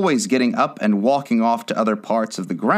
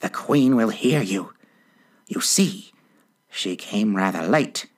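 hushed, anxious confiding; understated fearful undertone; frightened whisper. A narrator's reading. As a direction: confide it nervously, half-whispered. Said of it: "whispered in a frightened tone".

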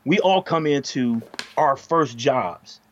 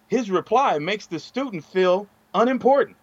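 ordinary talk, nothing marked

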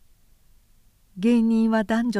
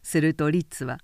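usual speaking voice

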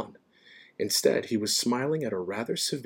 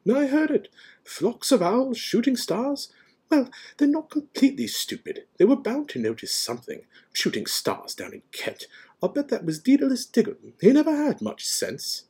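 high-pitched, scornful tirade; impatient; 5 dB louder than usual. A narrator's reading. McGonagall scorning the shooting stars, impatient. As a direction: rant in a high, exasperated voice. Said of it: "she said impatiently".

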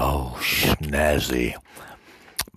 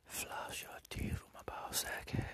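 softer whispered taunt; faint whisper; 17 dB quieter than usual. Acted. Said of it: "whispered repeat".

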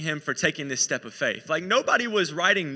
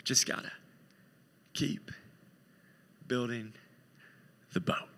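soft, low, long pauses between words; hushed, halting resolve; quiet perseverance; 7 semitones lower, 7 dB quieter than usual. Acted. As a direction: plod on word by word. Acting Noah plodding on for decades.

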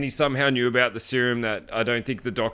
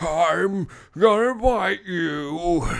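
higher, slow, louder monster voice; Frankenstein voice; 7 semitones higher, 4 dB louder, much slower than usual. Retold or imitated. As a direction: drag it out like a lumbering Frankenstein. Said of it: "like, a Frankenstein voice".

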